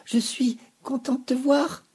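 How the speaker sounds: high, loud, gulping question; hiccupping. A narrator's reading. Vénou hiccups at seeing Sophie. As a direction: gulp the words in shock. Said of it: "hoqueta-t-il".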